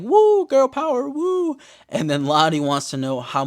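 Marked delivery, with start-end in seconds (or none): 0.0-1.6 much higher, louder whoop; hyped mock cheer. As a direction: cheer the girls on. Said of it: "And I'm like".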